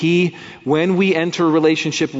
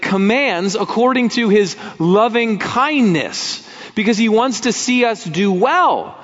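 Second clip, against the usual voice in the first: higher, driving sermon delivery; impassioned preaching; 6 semitones higher than usual. Acted. Preacher urging congregation, impassioned full-force preaching. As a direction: preach it with rising fire.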